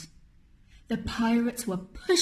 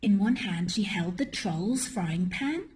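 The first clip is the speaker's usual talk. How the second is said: plain reading voice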